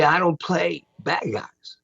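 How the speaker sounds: slow, deliberate retold line; Rutger Hauer quote. Retelling what the star told him, slow and deliberate. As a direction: lightly quote a veteran actor, drawn out. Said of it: "he told me".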